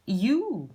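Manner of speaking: यू spoken as one "yoo" with a y glide, not "e-u"; यू with a clear y glide; yu sound. Shown as a demonstration. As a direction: say यू as "yoo", sliding up. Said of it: "ई यू नहीं बोला जाता".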